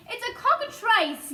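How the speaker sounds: high alarmed yell; panicked police chief yelling. A narrator's reading. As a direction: shout the warning up at the peach. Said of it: "yelled the chief of police".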